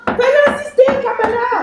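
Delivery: high, exasperated reproach; angry yelling. Acted. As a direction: scold your accomplice at a high pitch. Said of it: "arrêtez de crier".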